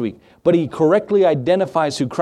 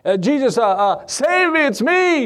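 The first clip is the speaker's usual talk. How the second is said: crying out; 10 semitones higher than usual. Acted. Blind beggar calling to Jesus, acted crying out. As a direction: call out pleadingly, stumbling over the uhs. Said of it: "he began to cry out and say".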